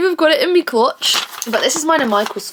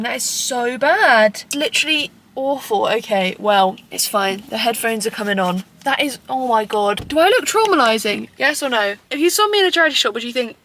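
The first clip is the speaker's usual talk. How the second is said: ordinary talk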